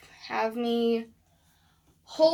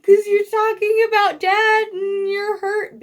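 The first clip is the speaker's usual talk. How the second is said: high, loud mock wail; tearful outburst, imitated at much higher pitch; imitated crying mother; 11 semitones higher, 11 dB louder than usual. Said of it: "my mom was crying".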